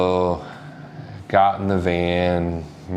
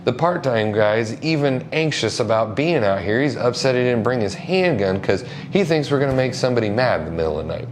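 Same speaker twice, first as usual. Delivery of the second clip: usual speaking voice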